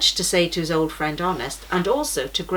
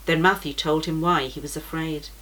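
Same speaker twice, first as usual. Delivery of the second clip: ordinary delivery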